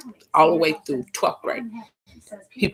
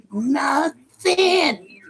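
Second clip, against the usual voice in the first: normal talking voice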